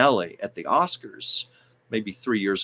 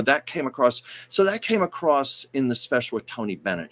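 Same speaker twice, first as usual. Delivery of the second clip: normal talking voice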